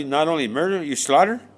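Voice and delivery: ordinary delivery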